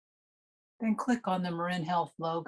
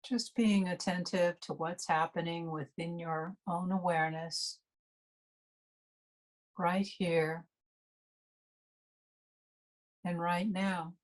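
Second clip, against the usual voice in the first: guided meditation. Real, not acted. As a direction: ease listeners unhurriedly into resting and noticing the breath.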